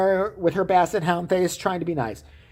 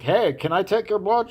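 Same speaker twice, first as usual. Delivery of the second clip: slightly put-on puppet-show voice; puppeteer asking; 3 semitones higher than usual. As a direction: lightly play the one working a puppet. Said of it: "I'll puppet the guy".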